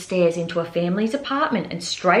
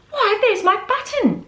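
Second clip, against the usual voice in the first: much higher joyful exclaim; happy cry; 12 semitones higher than usual. Acted. Bear spotting his lost button, joyful cry. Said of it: "he cried".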